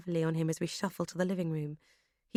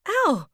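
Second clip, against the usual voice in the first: pained cry; 12 semitones higher, 8 dB louder than usual. Acted. Falls on ankle down stairs.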